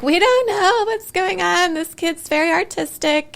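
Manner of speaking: high, slow, baffled parent voice; amazed parents. Retold or imitated. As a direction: play the stunned parents. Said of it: "they were like".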